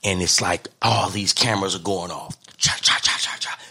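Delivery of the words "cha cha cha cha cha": whispered cha cha clicks; camera shutter clicks. Retold or imitated. Imitating camera shutter sounds.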